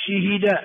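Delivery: شهد with kasra on shin and ha; vowel harmony. Shown as a demonstration. Teacher drilling four forms, last "shihida". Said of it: "أربع لغات".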